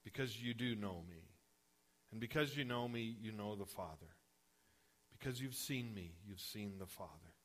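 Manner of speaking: soft, gentle reassurance; Jesus gently reassuring Thomas; tender-hearted. Retold or imitated. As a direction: speak it softly to a doubting friend. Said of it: "gets a little bit tender-hearted".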